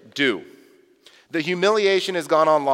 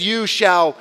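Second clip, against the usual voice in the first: slow, deliberate reading of Peter's refusal; a hint of Peter digging in; belligerent Peter; 5 dB louder, much slower than usual. Peter refusing Jesus, slow and firm. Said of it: "he says".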